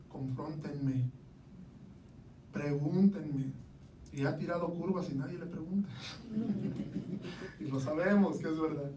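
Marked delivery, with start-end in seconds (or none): 0.1-3.5 drawn-out, spaced words; quoting the pastor very slowly and deliberately. Retold or imitated. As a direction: stretch each word out very slowly. Quoting pastor, each word drawn out.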